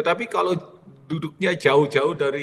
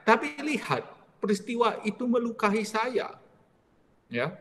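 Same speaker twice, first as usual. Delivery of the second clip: normal talking voice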